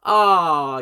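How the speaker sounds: ordinary talk, nothing marked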